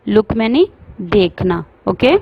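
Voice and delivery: usual speaking voice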